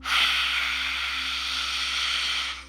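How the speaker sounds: long, airy exhale through the mouth; deep sigh. Shown as a demonstration. Guide demonstrates the exhale for meditators, long deep sigh. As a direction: let a slow breath stream out in a sigh. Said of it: "exhale for five seconds out of your mouth".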